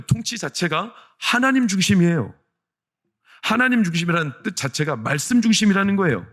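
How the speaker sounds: very high, loud sermon climax; full-force preaching. Real, not acted. Pastor correcting misunderstandings about church, full-force preaching.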